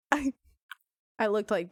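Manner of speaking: ordinary talk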